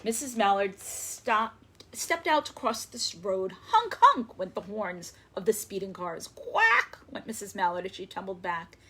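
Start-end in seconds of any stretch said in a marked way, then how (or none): 3.7-4.4 honk honk. A narrator's reading. Car horns in read story. 6.3-7.0 high, loud quack; startled mother duck quack. A narrator's reading. Duck quacks in read story.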